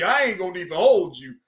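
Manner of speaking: normal talking voice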